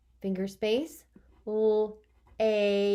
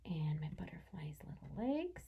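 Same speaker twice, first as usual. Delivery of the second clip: whisper; 4 semitones lower, 12 dB quieter than usual. Real, not acted. Teacher whispering while drawing.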